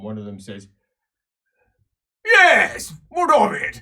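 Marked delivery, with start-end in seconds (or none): 2.2-3.8 loud, high, rowdy dwarf bellow; shouting dwarf slayer; gruff dwarf shout. Acted. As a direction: bark it back like a drunk dwarf. Drunk dwarf slayer retorting.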